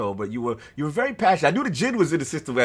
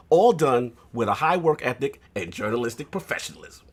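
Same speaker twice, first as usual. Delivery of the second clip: ordinary delivery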